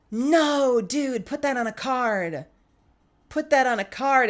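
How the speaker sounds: high, drawn-out exclamation; delighted protest. Real, not acted. Streamer loving a viewer's Valentine line, delighted protest. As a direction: exclaim it high and slow, bowled over.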